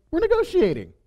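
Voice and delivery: a self-satisfied schemer's squeak; smug Balaam thought. Retold or imitated. Balaam voiced high as he imagines himself negotiating.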